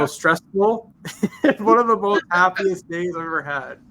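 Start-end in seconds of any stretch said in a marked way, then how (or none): 1.0-3.8 laughing. Real, not acted. Laughs while recalling a happy day.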